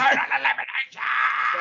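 "Total elimination" flung up high like a hyped commentator's call; Joey Styles call. Retold or imitated. Cohost mimicking the wrestling commentator, hyped high call. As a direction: belt out "Total elimination" the way a TV announcer names a move. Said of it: "Like Joey Styles".

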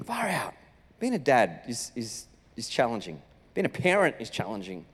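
low, soft, exhausted; worn-out sigh. Retold or imitated. Dad recalls the vomit day, low and worn out. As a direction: let it sink out of you, spent. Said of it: "I just remember thinking".

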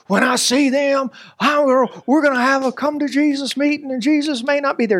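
hammed-up fury about the leader; frustrated threat. Boss fuming to his team about a leader, mock angry. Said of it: "so frustrated with the leader".

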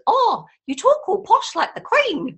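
imitating a posh English Queen accent; mock posh Queen accent. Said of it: "everybody goes".

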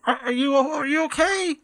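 high, muffled call from behind rocks; muffled yelling voice. Acted. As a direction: call out from behind a rock wall. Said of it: "a muffled voice yelling from behind the rubble".

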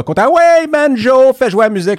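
exclamation très aiguë et forte; cri aigu enjoué. Pretend. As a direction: lance la musique en criant, très haut. Cue musical lancé en blague. (cri aigu et enjoué)

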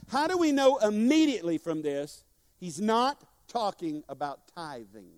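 ordinary talk, nothing marked

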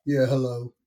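a hint of a menacing rich husband calling; put-on man voice. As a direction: drop it down like a tough husband. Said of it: "change my voice and take a man voice".

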